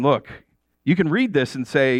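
ordinary talk

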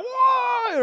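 very high, drawn-out mock whoa; fan cheering. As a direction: imitate a thrilled fan's cry. Fan thrilled at the trophy.

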